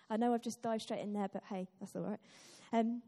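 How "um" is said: ordinary delivery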